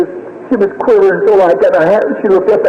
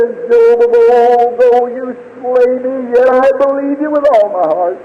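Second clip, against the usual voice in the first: profess faith through heartbreak; grieving faith; 7 semitones higher than usual. Retold or imitated. Bereaved father to God, grieving but faithful.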